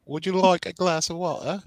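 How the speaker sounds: high-pitched, put-on posh British; mock posh British accent. American teasing a Brit about sounding posh, mock posh accent. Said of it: "Sounding very posh".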